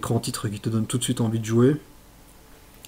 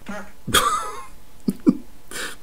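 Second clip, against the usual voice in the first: high, breathless squeaky laugh; breathless giggle; 7 dB quieter than usual. Real, not acted. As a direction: let out a helpless wheeze of laughter. Rire après l'échec. (petit rire aigu et soufflé)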